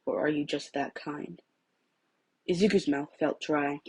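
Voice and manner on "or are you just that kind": soft, slow, gentle question; hushed gentle question; quiet. Acted. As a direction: ask it softly and slowly. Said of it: "Shota said quietly".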